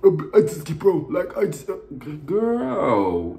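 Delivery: hammed-up blubbering imitation; mock crying. Mimicking the castmate crying on the phone. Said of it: "this bitch".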